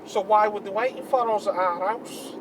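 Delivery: lightly voiced Manc lad; Manc accent. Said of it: "I got the accent back".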